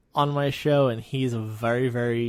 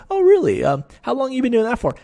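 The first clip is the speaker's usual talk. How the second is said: high, loud mock-impressed question; sarcastic coach, feigning interest; 11 semitones higher, 6 dB louder than usual. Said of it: "very sarcastic".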